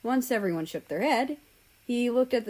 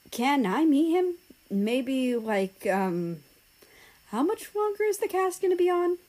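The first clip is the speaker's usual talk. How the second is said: eager high voice; 7 semitones higher than usual. Acted. Romania eager to meet boyfriend.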